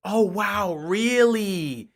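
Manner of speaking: drawn-out mock wonder; dripping fake wow; sarcastic amazement. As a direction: feign being amazed. Sarcastic reaction to coach's quote.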